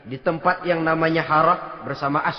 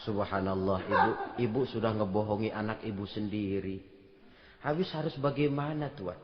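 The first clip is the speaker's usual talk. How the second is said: softer and lower, gentle dismay; quiet saddened murmur; hushed dismay; 10 semitones lower, 10 dB quieter than usual. Retold or imitated. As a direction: keep it low beside the sleeping child. Quiet exchange, child asleep.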